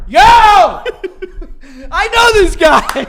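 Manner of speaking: high, drawn-out excited holler; huge mock bellow, cracking up; imitated scream. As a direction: mimic a fan yelling across seats. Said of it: "And Frankie's screaming".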